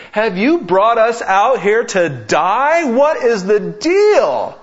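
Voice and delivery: high, loud whining shout at Moses; high and loud, the Israelites yelling their complaint at Moses; grumbling Israelites. Retold or imitated. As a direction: rail at Moses as if dying of hunger. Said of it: "Moses and they say".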